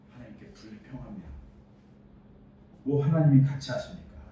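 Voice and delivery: ordinary delivery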